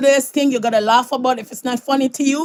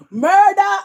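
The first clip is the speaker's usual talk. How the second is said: higher, louder mock cry of murder; mock outcry; 8 semitones higher, 5 dB louder than usual. Real, not acted. Joking about the slapped child, mock outcry. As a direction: yell it in joking alarm. Mock outrage at slap story.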